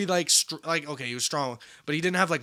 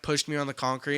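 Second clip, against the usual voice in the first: ordinary delivery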